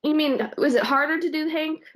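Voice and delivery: ordinary talk, nothing marked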